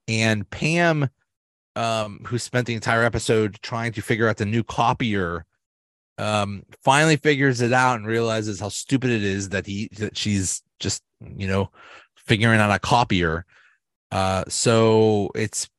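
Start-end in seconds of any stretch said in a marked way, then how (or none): none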